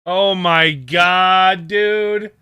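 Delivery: loud, high, long-drawn groaned words; real fed-up wail at a co-host; exasperated outburst. Real, not acted. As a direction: stretch every word out in exasperation. Exasperated at co-host's mic blunder.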